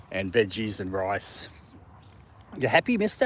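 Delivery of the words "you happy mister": pet talk. Real, not acted. Talking sweetly to the dog.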